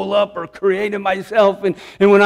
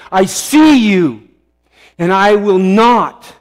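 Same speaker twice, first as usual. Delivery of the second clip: louder and slow, each word planted; defiant declaration; 2 semitones higher, 6 dB louder, slower than usual. Retold or imitated. Preacher retelling how he faces down the enemy, loud and defiant. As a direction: stand up to the enemy.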